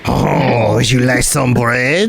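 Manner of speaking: slow, put-on French ghoul voice; lightly voiced French ghoul; French ghoul accent.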